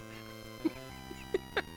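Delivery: very high, breathy squeal of laughter; helpless laugh. Real, not acted. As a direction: crack up in disbelief. Fails jump on pig.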